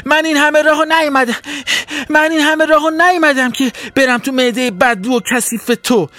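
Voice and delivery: high, fast panicked fish cry; desperate shout. Acted. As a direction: yell it while struggling. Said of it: "فریاد زد".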